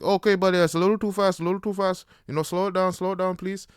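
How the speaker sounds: a hammed-up nervous instructor; mock instructor warning. Instructor warning about speed.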